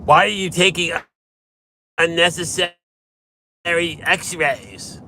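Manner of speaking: put-on a touch of an indignant old patient; whiny patient. Mimicking complaining patient.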